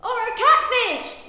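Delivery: high, loud excited yell. A narrator's reading. Lark tops the other pet suggestions, yelling. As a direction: yell out a wild idea. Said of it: "yells Lark".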